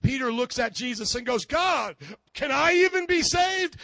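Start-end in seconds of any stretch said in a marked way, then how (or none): 1.5-3.8 high, loud panicked plea; freaked out. Acted. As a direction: cry out in alarm to Jesus. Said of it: "Peter is freaked out".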